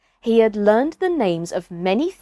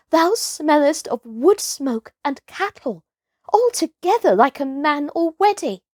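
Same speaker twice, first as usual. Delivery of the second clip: lightly voiced wolf; 5 semitones higher than usual. A narrator's reading. Wolf Grey Brother speaks.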